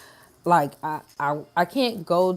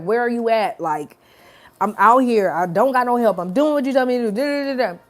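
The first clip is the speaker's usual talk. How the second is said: louder, higher, venting at God; frustrated rant; 4 semitones higher, 5 dB louder, faster than usual. Retold or imitated. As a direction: pile on the complaints, getting louder. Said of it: "I just had a whole spiel".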